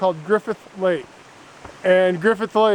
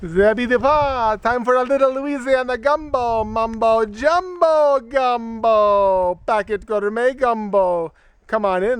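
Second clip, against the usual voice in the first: hammed-up Louisiana Cajun accent, higher, drawn-out gumbo rhyme; silly put-on Louisiana Cajun sing-song voice; mock Cajun accent; 5 semitones higher than usual. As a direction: play a goofy Louisiana Cajun cook showing off his gumbo. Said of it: "What kind of an accent is that?".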